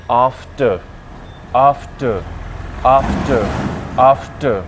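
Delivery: after said "aaf-ta", first vowel long; long aa sound. Shown as a demonstration. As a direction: stretch the opening "aa" of after. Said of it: "इसको बोलते हैं".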